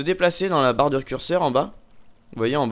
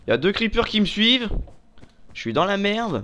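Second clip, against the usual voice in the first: high, loud panicked gamer; panicking gamer; 8 semitones higher, 3 dB louder than usual. Real, not acted. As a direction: panic as creepers chase you. Creepers chasing him.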